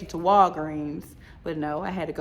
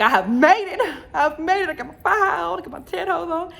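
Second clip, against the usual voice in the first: high, loud burst of triumph; elated; 9 semitones higher, 6 dB louder than usual. Retold or imitated. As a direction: celebrate it in a raised, excited voice. Joyful reaction retold after waking.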